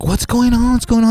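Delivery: retold worried questioning; concerned friend. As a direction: pitch it up in mock concern. Friend arrives, acted out.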